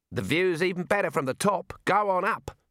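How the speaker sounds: put-on high, hearty old wizard; Arch-Chancellor voice. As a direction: cheerfully urge him up the ladder. Arch-Chancellor urging upward.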